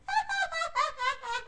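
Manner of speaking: shrill mocking cackle from a girl; high cackling laugh. Rose laughing at the magic axes, high cackle. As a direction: laugh high and nasty at the sight.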